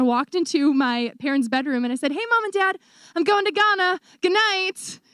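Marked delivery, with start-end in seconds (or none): 2.1-5.0 higher-pitched, offhand sing-out; breezy announcement. Retold or imitated. Daughter telling her parents at bedtime, breezy announcement. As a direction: re-enact a casual bombshell before bed.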